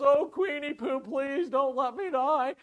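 high, loud hammed-up begging; wheedling mock panic; mock pleading. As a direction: beg for your life, comically desperate. Haman acted begging for his life.

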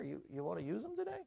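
soft, quick, rising question; hushed, tentative acted suggestion; sly suggestion. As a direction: offer it softly as a sly servant. Servant slyly suggests the gallows.

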